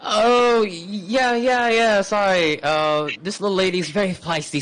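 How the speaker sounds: high-pitched, loud, stammered excuse; flustered cover-up. Acted. Leo excusing the noise to a servant, flustered.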